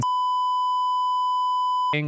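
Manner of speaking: tiny high-pitched laugh; squeaky high laugh. Shown as a demonstration. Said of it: "I can".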